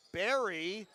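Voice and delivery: loud, high cry on the basket, then a slow emphatic call; excited play-by-play. Real, not acted. Commentator celebrates Barry's shot cutting the lead, excited call. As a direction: erupt on the score and stay up.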